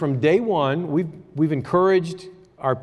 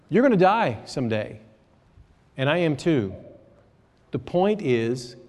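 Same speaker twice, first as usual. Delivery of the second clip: ordinary talk, nothing marked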